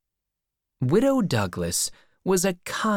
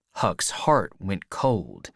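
plain reading voice

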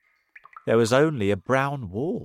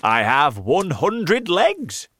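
high, loud and slow boast; happy centipede; 8 semitones higher, 8 dB louder, slower than usual. Acted. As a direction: boast it happily. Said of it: "the centipede answered happily".

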